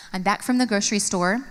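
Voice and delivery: ordinary talk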